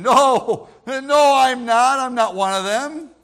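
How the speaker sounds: loud, slow, panicked denial; Peter denying. Acted. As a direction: deny it loudly, like Peter at the fire. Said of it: "Because he looked like one".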